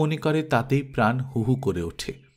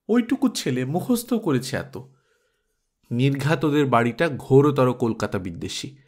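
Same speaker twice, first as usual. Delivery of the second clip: usual speaking voice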